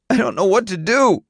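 high, helpless near-crying protest; tearful, voice wet. A narrator's reading. Son confessing helplessness to his father, tearful and high. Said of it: "his voice wet with mucus".

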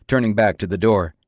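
plain reading voice

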